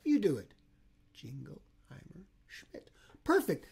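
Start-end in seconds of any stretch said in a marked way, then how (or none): none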